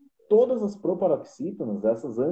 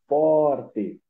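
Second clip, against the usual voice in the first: "forte" with FOR louder and drawn out; stress on the first syllable; 6 dB louder than usual. Shown as a demonstration. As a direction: lean hard on the FOR in "forte". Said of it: "pronunciá-la de forma mais forte". ("forte" com a sílaba FOR bem marcada)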